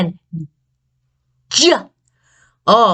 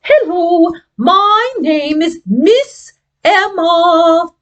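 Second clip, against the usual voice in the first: slow, higher prim voice; proper lady; 7 semitones higher, slower than usual. Acted. Miss Emma the prim puppet introduces herself. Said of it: "she's so proper".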